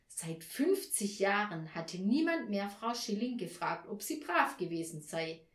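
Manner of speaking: plain reading voice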